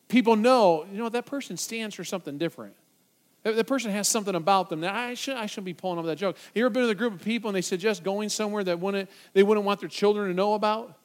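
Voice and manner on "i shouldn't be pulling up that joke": normal talking voice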